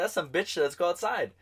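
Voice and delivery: high, scornful challenge; retold comeback. Answering a rival's taunt in a bar, high challenge.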